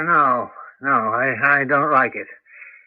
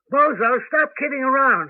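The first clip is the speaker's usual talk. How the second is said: higher, raised call across the room; calling out to the dog; 7 semitones higher than usual. Acted. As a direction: call out with growing worry. Grandpa calling the missing dog.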